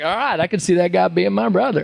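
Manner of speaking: easygoing star giving his blessing; Matthew McConaughey. Retold or imitated. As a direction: mimic a Southern swagger. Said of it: "he was like".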